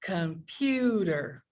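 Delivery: "computer" slowly, with a long PU; com-PUUU-ter, stressed "computer"; stress demonstration. Shown as a demonstration. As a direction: open up the middle syllable of "computer". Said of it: "would be something like".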